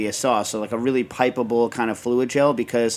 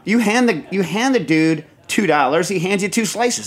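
ordinary talk

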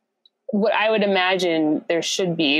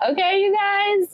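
excited podcast host; 13 semitones higher than usual. Acted. Show intro to listeners.

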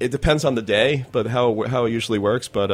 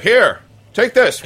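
high, eager farmer pushing a tomato on her; mock pushy farmer, voice pitched up; farmer offering food; 9 semitones higher, 6 dB louder, slower than usual. Farmers urging the reporter to taste, eager high voice. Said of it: "the guys will be like".

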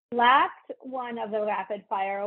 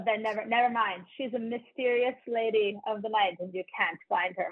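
ordinary delivery

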